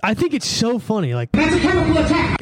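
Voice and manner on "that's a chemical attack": high, put-on exclamation; hammed-up alarm; imitated outburst. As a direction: cry out the catchphrase in a strained high voice. Quoting a favorite show line.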